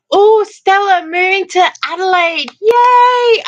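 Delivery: high, loud delighted cheer; excited greeting. Real, not acted. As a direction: greet her with a big happy shout. Greeting excited viewer moving.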